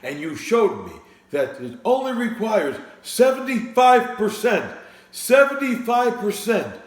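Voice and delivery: a cranky politician scolding the wine seller, loud and high; Bernie Sanders impression. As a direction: harangue him like a fed-up candidate at a debate. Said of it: "Larry David's impersonation of Bernie Sanders".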